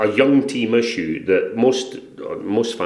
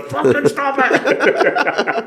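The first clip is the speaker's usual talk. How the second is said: very high, drawn-out squeal; hammed-up squeaky shriek; frantic producer; much slower than usual. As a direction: stretch it into a shriek. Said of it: "the kid's going".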